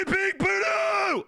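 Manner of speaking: very high, slow, full-throated yell; stretched all-out shriek of a name; name scream. Real, not acted. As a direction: scream the name as loud as you can. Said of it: "scream Fluffy as loud as I can".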